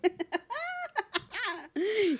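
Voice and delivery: long, high laugh trailing down; real laugh. Real, not acted. Laughing at co-host's healing joke, high laugh. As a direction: laugh high and let it fall away.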